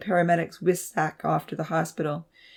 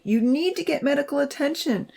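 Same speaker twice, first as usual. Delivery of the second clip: high-pitched worried plea; anxious, high and insistent; begging; 9 semitones higher than usual. A narrator's reading. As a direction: beg him to lie still. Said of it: "she begged".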